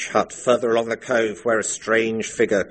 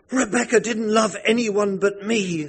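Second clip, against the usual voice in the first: much higher, strange obsessive voice; manic intensity; 12 semitones higher than usual. Acted. Missus Danvers claims Rebecca at the inquest, manic and high. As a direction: say it with mad devotion. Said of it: "with a manic, sapphic intensity".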